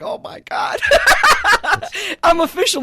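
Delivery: breathy gasp bursting into high laughter; giddy, high, laughing delight. Real, not acted. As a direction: laugh out loud through the words. Laughs in delight at being paid.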